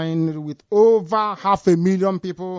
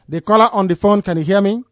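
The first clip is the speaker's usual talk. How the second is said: raised voice calling out; to someone hard of hearing; 3 semitones higher, 7 dB louder than usual. Real, not acted. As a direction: call loudly down the line. Calling to caller on the line.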